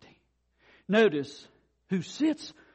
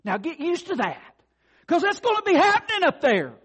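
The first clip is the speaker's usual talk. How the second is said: much higher, louder, thrilled; excited exclaim; 9 semitones higher, 4 dB louder than usual. Real, not acted. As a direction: burst out with joy.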